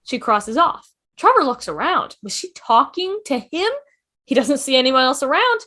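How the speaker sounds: ordinary talk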